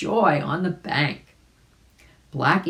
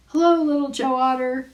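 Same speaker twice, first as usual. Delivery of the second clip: high character voice; 10 semitones higher, 4 dB louder than usual. Acted. Billy Mink greets the otter.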